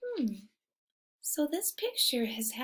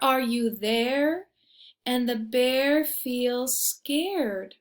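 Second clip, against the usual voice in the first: lively storybook reading; reading to children; 8 dB louder, slower than usual. A narrator's reading. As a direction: read it like a bedtime picture book. Reading picture book aloud.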